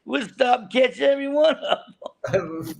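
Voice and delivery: high-pitched, through laughter; laughing comeback. Retold or imitated. Angler answering the skipper about fishing for Dorado, laughing. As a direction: retell the retort while cracking up.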